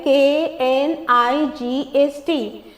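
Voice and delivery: slow letter-by-letter reading; spelling out. Shown as a demonstration. Teacher reading a word's spelling to learners, spelling out. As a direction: spell it slowly, each letter separate. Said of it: "इसके spelling के साथ हम लोग पढ़ते हैं".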